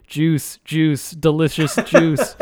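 slow, stiff robot butler voice; robot voice, words dragged out slowly. Retold or imitated. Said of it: "in, like, a robot voice".

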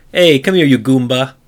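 hammed-up Italian street tough calling someone over; mock Italian tough guy. Mario calling an enemy over, in a loud mock Italian accent. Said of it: "he was like".

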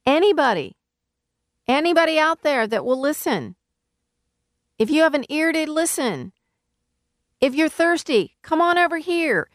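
high-pitched hawker's call, not full volume; acted megaphone shout. Crier on a platform offering free wine and milk, acted shout. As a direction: call out over a crowd as if through a megaphone. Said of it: "if I had a megaphone and I stood up on a platform and I just shouted".

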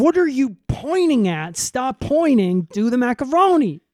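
hammed-up yelling at the pointing old man; mock yell. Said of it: "Cal's like".

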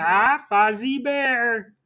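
high, slow puppet drawl; hammed-up puppet comedian, stretched out; Muppet bear. Friend joins the Muppet game, slow high puppet voice.

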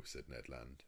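ordinary delivery

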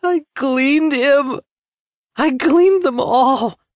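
high, slow, shrieking squeal; horrible squeal. Acted. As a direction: squeal each word out, drawn and piercing. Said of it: "his words came out in a horrible squeal".

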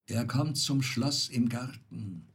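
ordinary delivery, nothing marked

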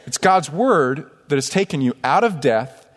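ordinary talk, nothing marked

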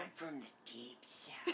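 strained, voiceless whisper of a movie impression; slow, whispered film-quote voice; Little Nicky. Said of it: "little Nicky".

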